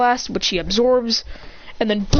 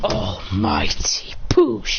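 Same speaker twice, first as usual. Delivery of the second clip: whispered deep technique call; 13 semitones lower, much slower than usual. Retold or imitated. Hero resists the portal, slow deep whisper. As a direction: whisper it very slowly, much lower.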